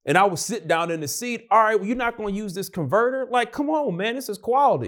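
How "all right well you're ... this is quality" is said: high, hurried exasperated protest. Retold or imitated. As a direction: plead in high-pitched exasperation. Acts out taking over the board.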